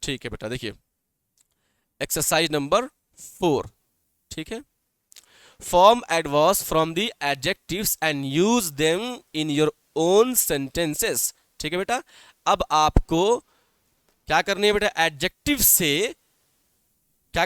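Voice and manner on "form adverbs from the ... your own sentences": very slow, word by word; slow dictation. Shown as a demonstration. Teacher reads exercise four to pupils, very slow dictation. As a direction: read the exercise out slowly for writing.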